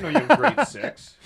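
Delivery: teasing exclamation. Real, not acted. Teasing retort to a friend.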